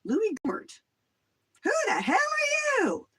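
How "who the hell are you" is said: high, loud Texan shout; mock Texan congressman yelling; Southern accent. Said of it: "Same accent".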